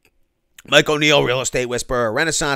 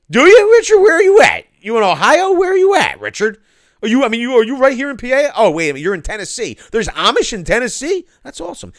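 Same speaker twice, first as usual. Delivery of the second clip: much higher and louder, squeaky excitement; excited squeal; 12 semitones higher, 12 dB louder than usual. Real, not acted. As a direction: squeal it like you can't believe it. Excited squeal at chatter Richard.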